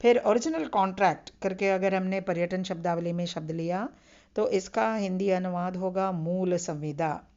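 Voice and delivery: ordinary talk, nothing marked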